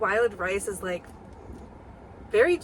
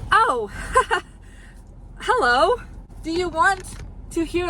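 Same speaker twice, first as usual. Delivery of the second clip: bright greeting; 10 semitones higher, 7 dB louder than usual. Real, not acted.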